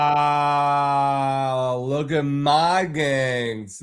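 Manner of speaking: slow, drawn-out scornful repeat; mocking echo. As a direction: stretch it out to ridicule his boast. Echoing the neighbour's boast, mocking.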